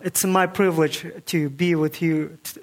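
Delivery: usual speaking voice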